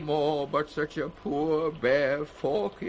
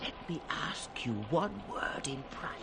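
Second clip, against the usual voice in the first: confiding aside; 9 dB quieter than usual. Acted. Lear asks a private word.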